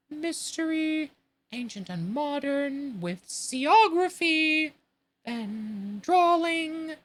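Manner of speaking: high, slow, mournful sea creature; Mock Turtle. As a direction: stretch each word like a sad old turtle. Mock Turtle listing subjects.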